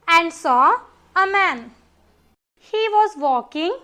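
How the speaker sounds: plain reading voice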